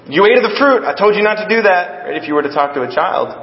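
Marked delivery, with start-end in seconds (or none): 0.1-2.1 accusing scold. Acted. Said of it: "You don't just go in and go".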